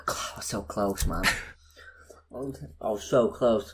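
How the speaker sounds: hushed, low disappointment; quiet, rueful whisper; rueful near-whisper. Real, not acted. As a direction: breathe it out softly, gutted at the near miss. Rueing a narrowly failed test.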